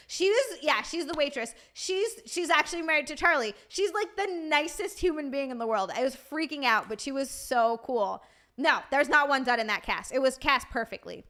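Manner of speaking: usual speaking voice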